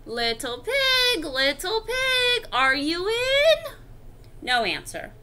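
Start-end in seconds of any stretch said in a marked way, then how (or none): 0.1-3.7 drawn-out singsong call, rising higher. Acted. Wolf at little pig's door, drawn-out calling out. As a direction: call slowly for someone inside. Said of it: "so I called".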